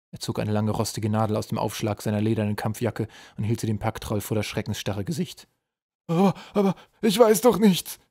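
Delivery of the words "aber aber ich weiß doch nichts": high, loud whimpering troll voice. A narrator's reading. Chained pack troll protests to his interrogator, whimpering. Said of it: "wimmerte der Packtroll".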